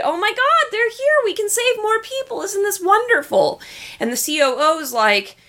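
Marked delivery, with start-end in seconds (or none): 0.0-3.6 high-pitched excited gushing; giddy, elated squeal of good news; all excited. Acted. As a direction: gush it in a high, thrilled voice. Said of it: "she's all excited".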